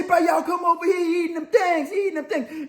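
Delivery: high hollering that breaks into laughs; mock shout with laughter. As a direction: call the others over, laughing.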